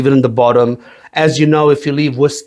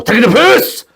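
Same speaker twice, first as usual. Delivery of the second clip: emphatic repeat; 9 semitones higher, 4 dB louder than usual. Acted. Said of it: "they taking the piss more and more".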